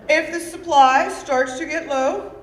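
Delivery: loud, emphatic dad laying down rules; dad's loud, deliberate instructions; lightly voiced father. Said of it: "And he told me this".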